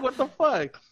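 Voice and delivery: put-out, half-joking disbelief; mock outrage. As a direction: retell a baffled complaint. Acts out indignant reaction to unfairness.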